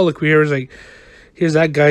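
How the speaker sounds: ordinary delivery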